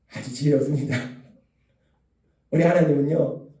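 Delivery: usual speaking voice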